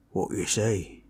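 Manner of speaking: ordinary talk, nothing marked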